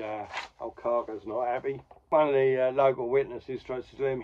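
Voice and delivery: usual speaking voice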